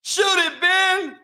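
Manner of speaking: high, loud yell at a teammate; imitating a yell. Kyrie yelling at Simmons to shoot, high loud yell. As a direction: bark it across the court. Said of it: "Kyrie yelling at him to shoot the ball".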